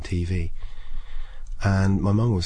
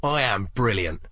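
bluffing cheer; 4 semitones higher than usual. Retold or imitated. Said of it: "I was like".

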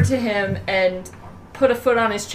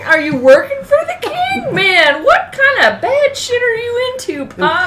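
much higher, shocked questioning; incredulous outcry; 13 semitones higher, 4 dB louder than usual. Acted. Daughter questioning Pop about the king, high incredulous outcry. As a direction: cry out in disbelief. Daughter appalled at father's news.

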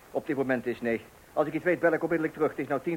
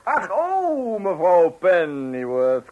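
effusive welcome; 6 dB louder, much slower than usual. Acted. Greeting the arriving help.

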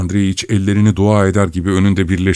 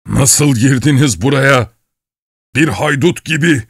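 higher, heated questioning; angry demand; 6 semitones higher than usual. A narrator's reading. Old man interrogating the intruder, angry. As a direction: demand it indignantly, pitch raised.

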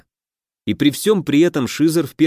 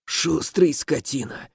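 slow, soft wounded wheeze; hoarse wheeze; 3 semitones higher, 6 dB quieter, slower than usual. Acted. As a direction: rasp it out, dying. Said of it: "прохрипел воин". (хриплый шёпот)

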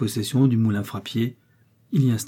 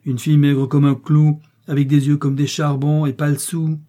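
loud, raised disparagement; scornful exclamation; 4 semitones higher, 6 dB louder than usual. A narrator's reading. Mother running down the penniless girl, scornful exclamation. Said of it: "s'écria-t-elle".

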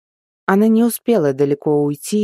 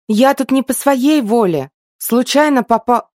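indignant; 8 semitones higher, 5 dB louder than usual. A narrator's reading. Heroine protests indignantly.

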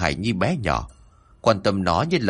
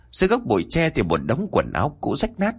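ordinary delivery, nothing marked

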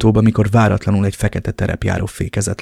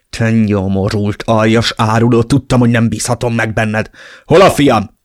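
furious shout; 4 dB louder than usual. Acted. Father roaring at the traitor on the phone. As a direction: spit it out shaking with rage. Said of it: "ordítottam a dühtől remegve".

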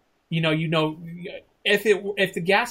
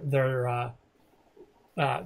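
ordinary delivery

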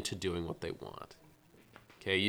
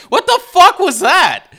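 much higher, loud disbelieving shout; incredulous yell; 14 dB louder than usual. Retold or imitated. Retold own disbelief outburst.

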